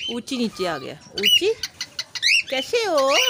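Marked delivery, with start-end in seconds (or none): 1.1-3.2 calling out high. Real, not acted. Calling bird by name.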